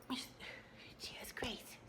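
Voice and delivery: soft unvoiced whisper to the dog. Real, not acted. Vlogger soothing her dog Gracie in a whisper. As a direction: hush it to the pet.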